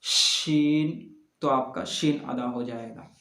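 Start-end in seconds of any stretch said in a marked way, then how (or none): 0.0-0.9 शीन stretched long, the sh hissed out slowly; slow drawn-out sheen. Shown as a demonstration. Teacher demonstrating the letter, very slow. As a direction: draw out शीन very slowly, tongue middle raised. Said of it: "इसको ऊपर सटाने के बाद आप बोलें".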